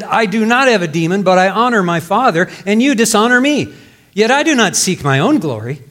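fervent pulpit reading; preaching. Acted. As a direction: proclaim Jesus' answer boldly. Preaching Jesus' reply.

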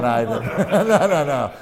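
laughing through the words; cracking up mid-line; real laughter while talking. Real, not acted. Laughs at punchline.

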